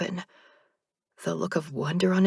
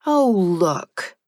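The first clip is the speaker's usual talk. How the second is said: sharp, louder venomous jab; 5 dB louder than usual. A narrator's reading. Narrator spits a venomous remark at the reaper. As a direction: spit it out with venom. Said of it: "I remark venomously".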